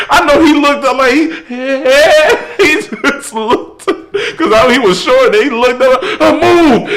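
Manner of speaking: high, loud words pushed out through laughter; laughing retelling. Real, not acted. Telling friends the story while cracking up, laughing. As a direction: tell it while cracking up, pitch way up.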